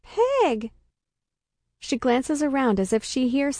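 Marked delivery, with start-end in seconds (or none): 0.0-0.7 high, held-back shout of a name; high-pitched call after someone. A narrator's reading. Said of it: "I shout".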